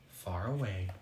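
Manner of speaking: ordinary delivery, nothing marked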